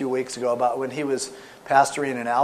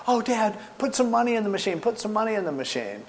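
high-pitched imitation of an eager kid; begging child; 7 semitones higher than usual. Small son to dad at the casino, pleading. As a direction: plead like a little boy, pitch easing down.

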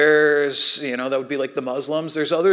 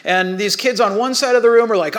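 usual speaking voice

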